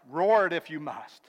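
normal talking voice

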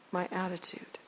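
hushed, breathless final words; whispered confession. Retold or imitated. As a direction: let the answer sink to a whisper. Bully's quiet heartfelt answer.

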